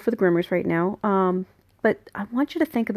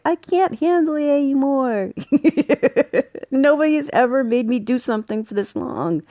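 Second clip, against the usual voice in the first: higher, whiny put-upon dog voice with a laugh; fed-up dog voice; 6 semitones higher than usual. Pretend. Groomer voicing a dog at its last nail, whiny fed-up dog. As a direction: play the dog who's had enough of nail trims.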